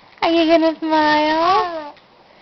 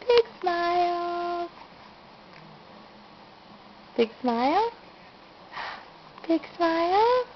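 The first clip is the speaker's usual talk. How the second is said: gentle coaxing; 3 semitones higher, 8 dB quieter than usual. Real, not acted. Coaxing baby quietly to smile.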